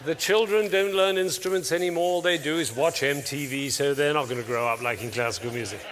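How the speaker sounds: loud doom-saying, pitch sinking as it goes; mock pessimist, sliding down to the conclusion; downward spiral speaking. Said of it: "what I call downward spiral speaking".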